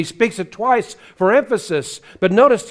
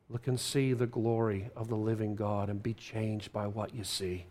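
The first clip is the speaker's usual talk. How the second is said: softer, intimate plea to the congregation; hushed pleading; 6 semitones lower, 10 dB quieter than usual. Real, not acted. Pastor pleading softly with his flock. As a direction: lean in and plead quietly.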